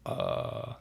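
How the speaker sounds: long creaky, low "uh"; vocal fry. Shown as a demonstration. Coach showing what the doctor needn't do, vocal fry. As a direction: let the voice crackle down into fry. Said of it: "that's called fry".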